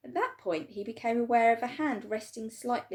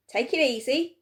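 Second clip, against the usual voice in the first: shopkeeper voice; 5 semitones higher, 8 dB louder than usual. A narrator's reading.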